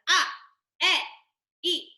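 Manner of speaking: hard glottal onset on each vowel; glottal attack. Shown as a demonstration. Voice teacher showing students a glottal attack on vowels. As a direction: start ah, eh and e with a sharp glottal click. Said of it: "So you hear that glottal attack?".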